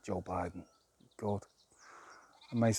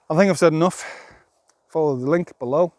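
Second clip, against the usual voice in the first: loud sign-off; 7 semitones higher, 11 dB louder than usual. Real, not acted. Signing off to viewers.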